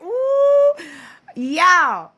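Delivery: high squealed ooh, he already knows; gleeful shocked gossip squeal; shrieking with amused disbelief; mock gasp. Mock gasp at reality show.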